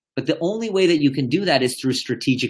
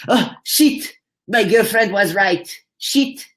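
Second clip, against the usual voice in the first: high-pitched mock French accent; 7 semitones higher, slower than usual. Coach imitating client admitting his girlfriend was right, French accent. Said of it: "He has a French accent".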